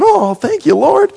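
high, loud cry of praise; fervent thanksgiving. Retold or imitated. Preacher acting the donor leaving, fervent thanksgiving. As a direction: call out thanks, overcome.

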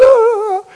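put-on loud high toddler wail; mock crying. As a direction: imitate a crying toddler's howl. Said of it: "is crying. She goes".

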